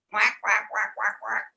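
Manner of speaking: lightly voiced quacks; mild duck imitation; duck quacking. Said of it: "said the duck".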